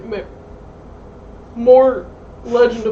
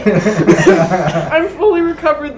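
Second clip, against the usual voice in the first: laughing; 7 dB louder, much slower than usual. Acted. Actor boasting in mock interview.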